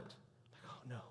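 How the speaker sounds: a breathless whispered "oh no"; dawning horror under the breath; whispered dismay. Retold or imitated. Pastor realising his mistake, whispered dismay.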